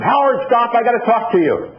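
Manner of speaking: retold yell. Flight attendant shouts to Howard across the airport, high call. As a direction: call out after him to stop, pitched high. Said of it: "this flight attendant yelled at me".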